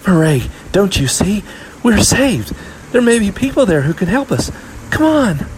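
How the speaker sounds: put-on high, loud girl rallying her sister; eager, high-pitched older sister; excited urging. A narrator's reading. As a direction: urge her on, thrilled at rescue.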